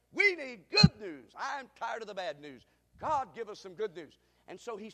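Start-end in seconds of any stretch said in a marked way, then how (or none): 0.1-1.3 loud, high, rising preacher's call; preaching at full force. Real, not acted. Preacher to the congregation, loud and rising. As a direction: lift the crowd toward the good news.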